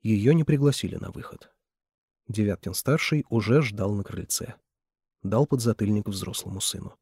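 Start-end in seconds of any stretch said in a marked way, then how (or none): none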